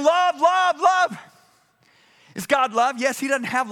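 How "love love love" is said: high, loud repeated cry. Acted. Angels supposedly crying to each other, loud shout. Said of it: "They're not crying".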